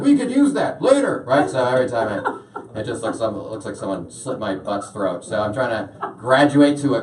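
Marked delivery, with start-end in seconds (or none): 0.0-1.2 high-pitched little inner voice; high, comic voice for a talking body. Retold or imitated. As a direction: pipe up as a thrifty body. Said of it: "your body's like".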